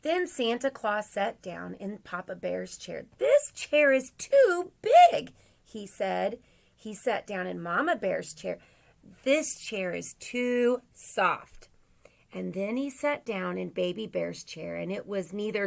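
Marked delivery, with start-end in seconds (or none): 3.2-5.3 high, loud, drawn-out Santa protest; slow, big storybook exclamation, pitched up; Santa complaining. Acted. Santa tries Papa Bear's chair. 9.2-11.7 slow, higher-pitched storybook grumble; Santa complaining. Acted. Santa tries Mama Bear's chair.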